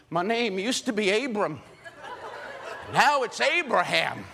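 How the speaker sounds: high, slow, laughing mock threat; comic old Abraham. Old Abraham waving his knife at the camp men, laughing mock threat.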